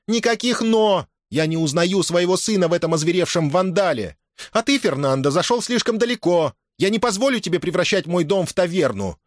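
high-pitched, forceful dressing-down; stern angry mother. A narrator's reading. Mother angrily lays down the law. (строгая гневная отповедь, высоким голосом)

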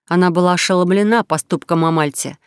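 ordinary delivery, nothing marked

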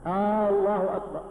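آه، الله أكبر with a long held opening "aa"; faulty takbir starting "aaallahu"; drawn-out questioning hamza. Shown as a demonstration. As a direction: stretch the first A of الله into a question. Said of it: "أنت الآن تستفهم".

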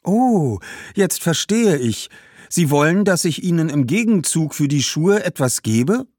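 put-on high-pitched girl, dawning understanding; young girl catching on. Girl learning that shoes must be paid for, dawning on her. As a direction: realise it and check politely. Girl grasps the idea of trade.

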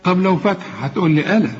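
usual speaking voice

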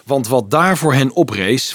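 plain reading voice

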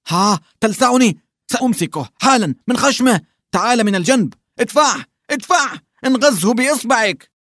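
rising frantic push at the fish; excited struggle. A narrator's reading. Hunt for the fish climax. (صيحات متحمسة وهو يصارع السمكة)

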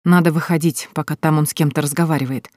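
plain reading voice

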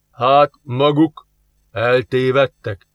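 slow, lightly voiced grumpy neighbour; suspicious grump. Grumpy neighbour sizes up the strangers, slow and suspicious. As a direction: drag the words out, eyeing strangers warily. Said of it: "gyanakodva végigmérte".

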